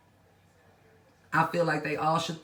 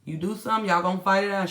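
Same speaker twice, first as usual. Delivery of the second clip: ordinary delivery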